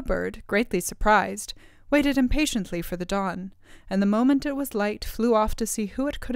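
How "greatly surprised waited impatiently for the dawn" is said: ordinary delivery, nothing marked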